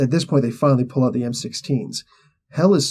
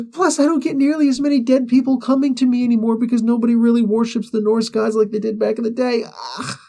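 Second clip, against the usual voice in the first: much higher mock shout of a furious goddess; high furious rant; 11 semitones higher than usual. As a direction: rage about the ruined ship. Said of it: "She's like".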